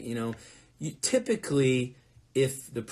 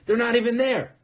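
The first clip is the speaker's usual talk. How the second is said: high, loud, incredulous exclamation; loud disbelief at being let down; exasperated complaint; 11 semitones higher, 8 dB louder than usual. Real, not acted. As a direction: pitch jumps way up in exasperation. Complaining that hosts are never prepared.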